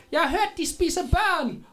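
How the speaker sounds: very high-pitched mock shout; crowd member's cry. Villager calls out a rumour to the heroes, high mock shout. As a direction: call out in a high put-on voice. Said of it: "der råber".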